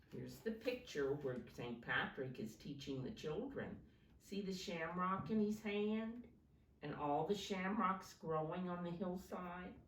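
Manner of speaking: ordinary talk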